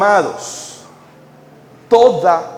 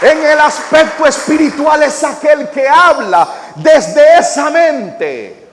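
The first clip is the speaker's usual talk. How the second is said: louder, higher, fiery pulpit delivery; full-force preaching; 9 semitones higher, 4 dB louder than usual. Acted.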